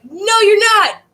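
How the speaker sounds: high, loud defiant shout, hammed up; mock angry yell. Teacher acting out angry Americans answering the British, mock yell. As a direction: yell back at the enemy in mock outrage. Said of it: "Everybody's yelling".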